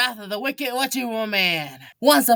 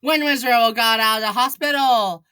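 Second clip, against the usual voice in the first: ordinary delivery, nothing marked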